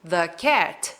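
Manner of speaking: "the cat" as "thuh cat"; short uh in "the" before "cat". Shown as a demonstration. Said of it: "essa pronúncia quando o the vem antes".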